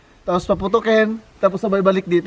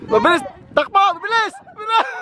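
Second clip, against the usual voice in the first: high, urgent shouts of encouragement; excited cheering; 11 semitones higher, faster than usual. Real, not acted. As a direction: hurry them up, voice cracking. Urging a runner.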